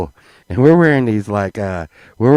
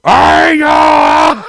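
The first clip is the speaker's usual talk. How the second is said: much higher, louder yell; angry shout; 12 semitones higher, 6 dB louder than usual. Retold or imitated. Big brother charging the boys at the pool, shouting. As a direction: bellow it at the kids. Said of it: "he was like".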